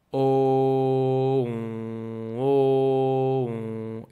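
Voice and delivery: teacher contrasting O and on; O then nasal on. Shown as a demonstration. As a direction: open O, then close toward on through the nose. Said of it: "Try to make the sound O".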